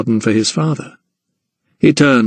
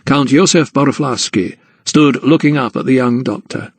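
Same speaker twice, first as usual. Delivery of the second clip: usual speaking voice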